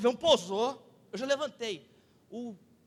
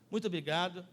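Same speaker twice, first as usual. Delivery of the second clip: pilot signing off; 5 semitones lower, 5 dB quieter, faster than usual. Retold or imitated. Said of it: "no final ele falou assim".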